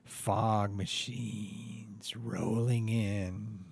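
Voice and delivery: slow, hushed whisper; spooky whisper. Acted. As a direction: whisper it like a ghost story. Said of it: "setting the spooky scene".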